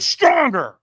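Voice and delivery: loud, high push; mock coach shout; demanding coach. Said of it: "And he went".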